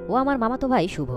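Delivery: usual speaking voice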